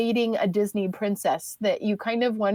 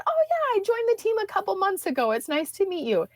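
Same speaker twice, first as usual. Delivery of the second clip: cheery reply; 8 semitones higher, faster than usual. Retold or imitated. Cashier answers cheerfully.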